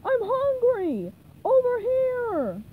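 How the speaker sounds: high, loud, drawn-out holler; hungry cartoon yelling; shout. A narrator's reading. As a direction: call across a distance, stretching the words. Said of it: "he shouted".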